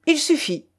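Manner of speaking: high, curt cut-off; cutting. A narrator's reading. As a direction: end the matter sharply. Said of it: "trancha Siméon Isidorovitch".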